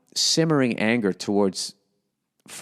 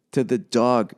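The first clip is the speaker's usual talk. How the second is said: ordinary talk, nothing marked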